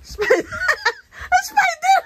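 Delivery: squealing half laughing in fright; high frightened squeals with laughs; screaming at a spider. Real, not acted. Spider found in room.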